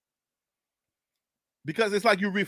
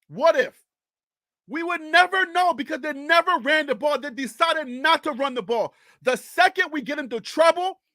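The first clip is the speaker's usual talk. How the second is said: high, agitated, emphatic rant; worked-up rant; 7 semitones higher than usual. Real, not acted. Streamer fuming to viewers about play-calling, worked-up rant. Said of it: "maybe at first I was screaming it".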